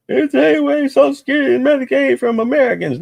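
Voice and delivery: high, fast whiny mockery; mocking Democrats. Mocking Democrats' complaint, high fast whine. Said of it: "That's what the Democrats are bitching about".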